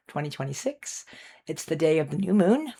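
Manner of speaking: ordinary talk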